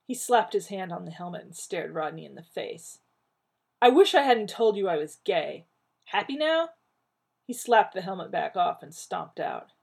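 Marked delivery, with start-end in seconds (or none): 3.8-6.7 angry. Acted. Angry confession, storms out.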